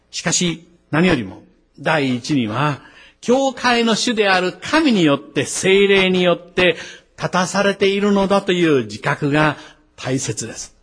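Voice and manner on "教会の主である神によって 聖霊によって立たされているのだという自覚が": higher-pitched, insistent pulpit delivery; fervent, raised preacher's voice; emphatic preaching. Real, not acted. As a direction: drive the conviction home to the congregation. Preacher driving the point home.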